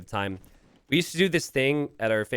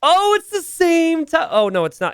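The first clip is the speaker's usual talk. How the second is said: excited; 9 dB louder than usual. Real, not acted. Caster reacting to close timing.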